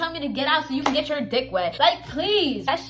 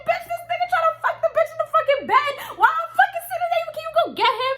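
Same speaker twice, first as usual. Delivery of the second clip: frantic retelling; 12 semitones higher, faster than usual. Retold or imitated. Reporting to friend in kitchen.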